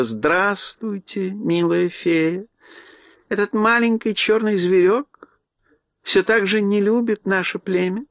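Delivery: gracious little queen, drawn out; mouse queen voice. Acted. Mouse queen greets Ellie.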